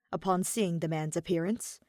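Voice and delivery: ordinary delivery, nothing marked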